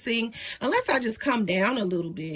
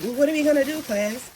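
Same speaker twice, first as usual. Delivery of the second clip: higher, playful teacher voice addressing the class; mock teacher; 6 semitones higher than usual. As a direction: play the schoolteacher quizzing her students. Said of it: "I used to be a teacher".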